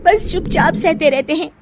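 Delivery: high, exasperated wife; frustrated wife. Acted. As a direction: push him to speak up. Wife scolds husband.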